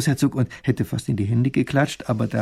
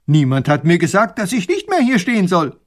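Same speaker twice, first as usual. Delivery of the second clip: higher, louder hurt protest; offended protest; 6 semitones higher, 8 dB louder than usual. Acted. Offended soldier protests to the professor, higher and louder. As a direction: defend yourself, wounded. Beleidigtes Gesicht.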